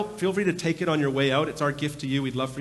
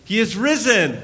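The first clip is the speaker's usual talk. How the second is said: loud, higher Easter call; He is risen called out loud and higher, falling on risen; 5 semitones higher, 4 dB louder than usual. Real, not acted. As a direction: throw it out to the crowd. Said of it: "So I'm gonna throw it out".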